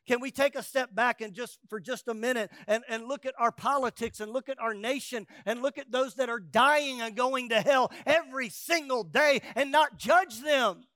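preaching at full force. Acted. Preacher pleading with the church not to judge, building to a cry. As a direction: build the plea until it peaks on judge them.

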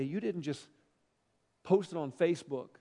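usual speaking voice

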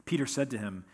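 soft, steady reading of the gospel text; scripture reading. Acted. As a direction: read the passage plainly and reverently. Scripture reading before the sermon.

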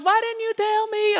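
put-on high, wailing little-kid voice; wailing toddler. Crying son blames father in high wail. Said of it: "says in his non-talking voice".

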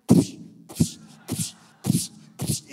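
loud, explosive hissed smacks; slap sounds. Retold or imitated. Hitting the panicking kid, percussive slap noises. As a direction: imitate repeated hard slaps.

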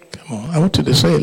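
normal talking voice

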